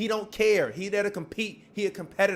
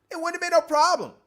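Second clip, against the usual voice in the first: high, loud, pressing the point; heated insistence; 10 semitones higher, 6 dB louder than usual. Real, not acted. Arguing LeBron's pout cost the game, loud and heated. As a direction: hammer home the argument.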